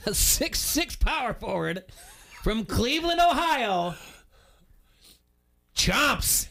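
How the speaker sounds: announcer intro. Pretend. Hyping his draft pick.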